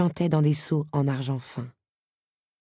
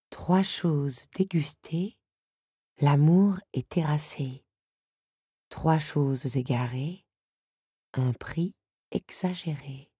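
slow message reading; 4 dB quieter, slower than usual. A narrator's reading.

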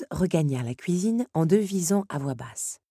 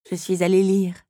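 sheepish; 4 semitones higher, 6 dB louder than usual. Acted. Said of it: "avoua penaude la jeune femme".

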